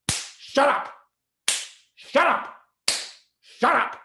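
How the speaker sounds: sharp high shouts, again and again; teacher yelling. Retold or imitated. Sixth grade teacher yelling at the class, loud shouts. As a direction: bark it at the class like an angry teacher.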